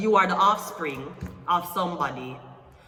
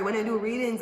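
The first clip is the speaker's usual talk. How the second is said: ordinary delivery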